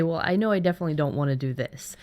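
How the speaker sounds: ordinary talk, nothing marked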